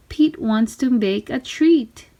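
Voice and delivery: usual speaking voice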